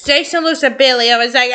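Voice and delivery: ordinary delivery, nothing marked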